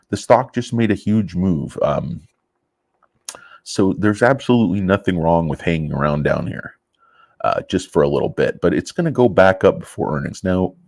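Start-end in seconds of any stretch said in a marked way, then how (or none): none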